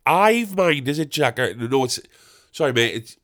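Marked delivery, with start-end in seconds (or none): 0.1-1.4 high-pitched imitation of a chatty labourer; nosy gardener. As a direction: mimic an over-familiar gardener, pitched up. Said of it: "replaced all the mates with Jack".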